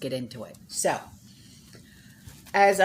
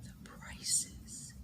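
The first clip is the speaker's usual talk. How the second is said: awed whisper; 14 dB quieter than usual. Real, not acted. Stunned by Russian gas prices.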